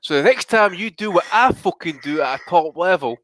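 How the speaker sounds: a hint of a ranting boxer, rising; half-hearted impression of a fighter telling critics off; mock Bellew rant. To the podcast lads, a lightly voiced mock rant. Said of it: "is that a quote from Bellew".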